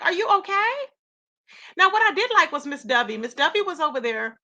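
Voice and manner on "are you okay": high, sarcastic "Are you okay?"; pretend-worried sarcasm; mock concern. Host mocking critics in chat, high sarcastic concern. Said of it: "I'm like".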